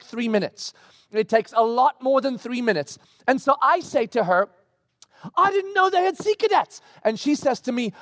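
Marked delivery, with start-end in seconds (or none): 5.4-6.8 high-pitched defensive outcry; mock exasperated protest. Husband to wife in morning quarrel, exasperated protest. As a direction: act out a flustered husband protesting.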